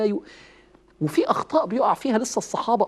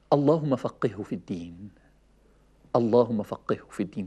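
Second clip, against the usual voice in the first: tender whispered prayer; 8 semitones lower, 4 dB quieter than usual. Acted. Prophet blessing young Ibn Abbas in his ear, tender and low. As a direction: murmur it gently like a hug.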